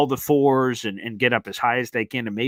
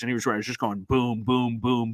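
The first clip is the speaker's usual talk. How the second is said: ordinary talk, nothing marked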